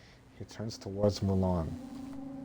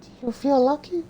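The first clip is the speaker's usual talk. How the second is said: put-on high falsetto girl voice; squeaky high-pitched heroine; Mulan voice; 6 dB louder than usual.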